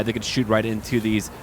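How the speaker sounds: ordinary delivery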